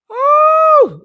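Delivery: high, loud excited ooh; hype whoop. Real, not acted. Host whooping before asking viewers to like the video. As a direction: belt out a big ooh.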